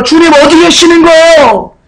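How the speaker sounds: high, slow acted wail; crying out. Believers crying to the Lord amid their losses, wailing. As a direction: stretch it into a desperate mock-tearful cry. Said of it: "죽게 울어요".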